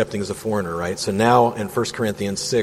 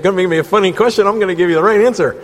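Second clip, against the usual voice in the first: quick teasing comeback, higher and louder; playful, fast retort; 8 semitones higher, 6 dB louder, faster than usual. Real, not acted. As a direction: toss back a cheeky answer. Playful retort to a joke question.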